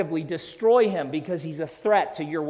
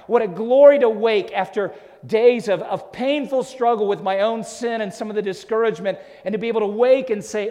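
swelling, loud preacher's cry; louder, rising pulpit voice; impassioned preaching; 6 semitones higher, 8 dB louder than usual. Acted. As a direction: preach it out with full force. Preaching at full force.

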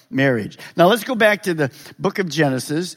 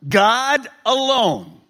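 "God alone" stretched, very slow; slow emphasis; 4 semitones higher, much slower than usual. Shown as a demonstration. Preacher stresses the bottom line, very slow. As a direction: lean hard on God alone. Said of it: "preacher emphasis".

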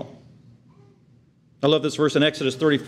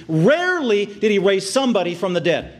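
normal talking voice

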